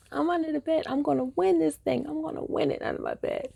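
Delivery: put-on a kid sure she's winning hide and seek; gleeful kid.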